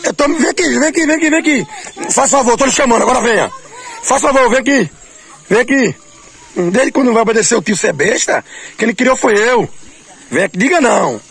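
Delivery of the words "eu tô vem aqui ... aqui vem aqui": high, laughing calls; playful coaxing. Real, not acted. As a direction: coax them over, teasing. Coaxing someone to come.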